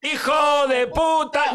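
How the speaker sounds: slow, high, stretched yell; a long, slow, high "hijo de puta" in joking fury; drawn-out mock outrage. Real, not acted. Friends mock-outraged at betrayal.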